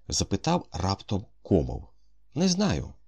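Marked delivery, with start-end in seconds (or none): 2.3-2.9 a quick, higher impatient brush-off; mildly impatient child. A narrator's reading. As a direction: brush the question aside impatiently. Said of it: "сказав малюк нетерпляче".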